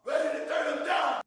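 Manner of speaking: high cartoon character voice; vintage cartoon voice. Real, not acted. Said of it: "Why is he talking like a cartoon character?".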